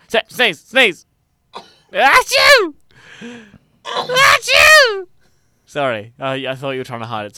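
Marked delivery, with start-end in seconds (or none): 0.1-1.0 urging call. Real, not acted. Calling out. 1.9-5.1 loud, high mock sneeze; hammed-up shouted achoo; fake sneeze. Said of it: "make it known that you were sneezing".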